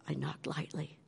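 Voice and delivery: soft unvoiced whisper. Real, not acted. Telling the listeners about the timid knock in a whisper. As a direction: hush it like tiptoeing.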